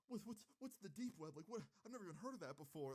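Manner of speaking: high, stammering mock-tearful wail; hammed-up high crying voice; imitated hysterical crying. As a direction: play a distraught mom sputtering through sobs. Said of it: "she's just crying, dude, like, hysterically".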